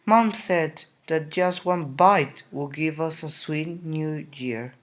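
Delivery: ordinary delivery, nothing marked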